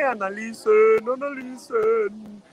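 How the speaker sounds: slow, high, drawn-out analicen; drawn-out tease. Acted. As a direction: stretch each word out teasingly. Énfasis juguetón, lento y agudo. (analicen lento y agudo, en broma)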